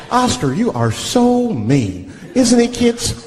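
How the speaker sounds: slow, sing-song host voice; kids' show host. Retold or imitated. TV host judging Oscar to kids, sing-song. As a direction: talk down like a TV presenter. Mocking Sesame Street host.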